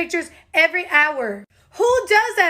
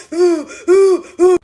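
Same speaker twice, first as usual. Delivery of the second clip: hammed-up loud sobbing whimpers; exaggerated mock crying ooh sounds; 7 dB louder than usual. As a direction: imitate a boyfriend blubbering. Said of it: "I can hear him going".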